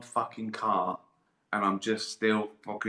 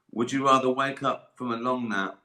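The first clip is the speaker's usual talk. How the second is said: ordinary talk, nothing marked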